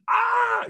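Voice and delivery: a sudden high loud shriek; retold scream. A woman screams on seeing Abu Bakr beaten. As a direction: let out one sharp piercing "Ah". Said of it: "she screamed".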